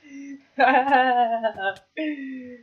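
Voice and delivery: loud laugh, drawn out; long nervous laugh. Real, not acted. Player who totally got this, long laugh. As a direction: laugh on and on after boasting. Laughs after the cocky boast.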